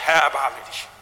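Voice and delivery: ordinary delivery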